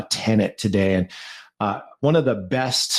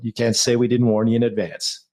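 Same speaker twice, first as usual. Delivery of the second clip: ordinary talk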